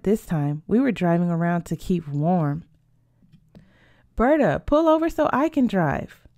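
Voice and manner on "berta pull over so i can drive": higher-pitched, eager call; eager request. Acted. Sophia asks Berta to let her drive, eager request. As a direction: pipe up high, wanting a turn.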